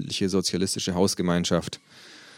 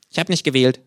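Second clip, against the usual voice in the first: mouth full; 6 semitones higher, 5 dB louder than usual. Acted. Kangaroo character voice.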